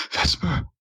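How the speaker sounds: high-pitched, urgent call; shouting a name. Acted. Game master voices Ayin calling to Vesper, shouting.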